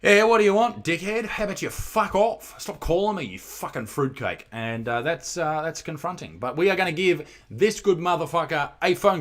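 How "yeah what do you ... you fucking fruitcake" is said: loud, high, mocking a grumpy person who got the call; mock rude answer. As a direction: snarl it like an annoyed stranger hung up on. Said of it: "they go".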